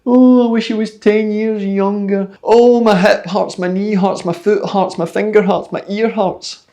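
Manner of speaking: put-on grumpy old man. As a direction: groan through every ache like an old fart. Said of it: "waking up like some saggy arse old fart".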